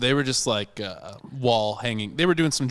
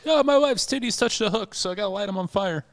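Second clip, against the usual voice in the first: high-pitched put-on husband voice; hammed-up, high-pitched complaint; mock aggrieved husband; 10 semitones higher than usual. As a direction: protest in a high, silly character voice.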